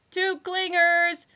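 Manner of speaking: high, loud, slow yell. A narrator's reading. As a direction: shout it down the hall. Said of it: "yelled a voice".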